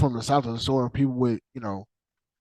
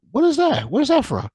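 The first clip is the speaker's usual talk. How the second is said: higher, eager questioning voice; a curious newcomer asking about it; curious buyer; 8 semitones higher, 3 dB louder than usual. Acted. Buyer asking the dealer, higher and eager.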